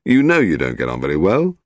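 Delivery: plain reading voice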